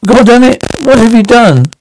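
high anguished outburst; horrified cry. Acted. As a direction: cry out in shock and dread. Shocked after the shooting.

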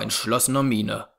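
ordinary delivery, nothing marked

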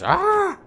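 loud, high mock dwarf war cry; Gimli's battle yell. Gamer copying the dwarf sound for viewers, loud high yell. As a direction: belt out the dwarf's yell as he leaps. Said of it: "what he makes when he goes".

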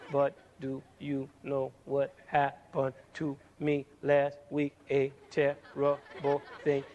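slow, low, unbroken monotone run-on; no pauses. Shown as a demonstration. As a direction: drone on without any rest or accent. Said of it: "what if we spoke like".

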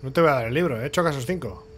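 ordinary delivery

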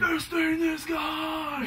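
high, slow metal shout; metal screamer voice. Pretend. Showing off his stage voice, slow high metal shout.

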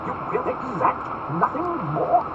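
high, slow, drawn-out incredulity; slow, loud, astonished echo; astonished, syllable by syllable. Acted. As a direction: dwell on every syllable in disbelief. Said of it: "dwelling on each syllable of the words".

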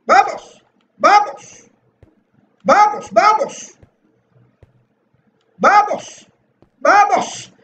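high, punchy repeated cheer; shouted rallying cry. Real, not acted. Gamer urging himself through the level, shouted rallying cry. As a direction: shout yourself on, pitched high. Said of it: "Voy a seguir gritando "vamos".

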